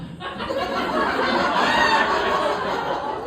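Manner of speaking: very high breathless laugh; shrieking laugh. Real, not acted. Comic cracking up at his car seat prank.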